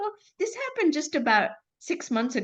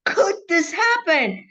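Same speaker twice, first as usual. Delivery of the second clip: indignant denial; 5 semitones higher, 6 dB louder than usual. Retold or imitated. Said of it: "I remember shouting in the dark".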